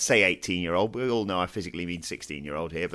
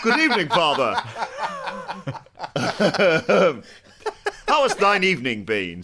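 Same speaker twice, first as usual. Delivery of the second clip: high, loud mock posh English accent with a laugh; a drunk lad hamming up a posh English accent; 10 semitones higher, 5 dB louder than usual. Drunk son greets his dad in a hammed-up posh English accent. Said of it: "put on a bit of a posh accent".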